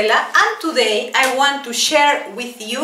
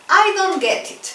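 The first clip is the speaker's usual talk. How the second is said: high model phrase; 7 semitones higher than usual. Shown as a demonstration. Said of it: "Let's repeat".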